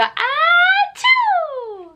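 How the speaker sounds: high playful fake sneeze "Ah, choo"; squeaky pretend sneeze for baby; mock sneeze. Shown as a demonstration.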